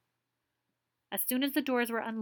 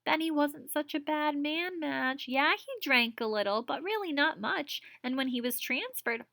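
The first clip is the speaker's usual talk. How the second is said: lightly voiced upset daughter, higher; a grown daughter pouring out her bad day; Madge, upset teacher; 6 semitones higher, 4 dB louder than usual. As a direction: Madge venting to her mother. Voicing Madge in read story.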